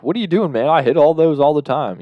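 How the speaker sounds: mock complaint, pushed up in pitch; Rondo. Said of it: "Rondo's just like".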